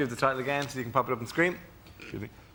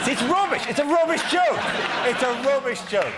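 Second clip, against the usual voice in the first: mock outburst; 10 semitones higher than usual. Real, not acted. Mocking his own joke.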